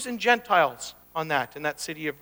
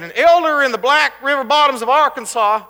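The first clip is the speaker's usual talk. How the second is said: put-on Arkansas accent; 6 semitones higher, 10 dB louder than usual. Preacher quoting an Arkansas author, in an Arkansas accent. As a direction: put on a thick Arkansas backwoods accent, raised and loud. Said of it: "I don't know if I'm doing the accent right".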